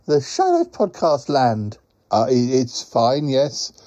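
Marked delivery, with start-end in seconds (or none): 0.1-1.8 high, sing-out character voice with quacks; cheerful quacking creature. Acted. Quacking friend greets Paul on a call, high cheerful creature voice.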